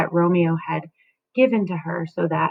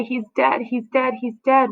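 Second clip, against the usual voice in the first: higher repeated lament; keen it, each dead raised; nurse lamenting; 6 semitones higher than usual. Acted. Nurse wailing to Juliet, higher mock lament. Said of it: "The nurse says".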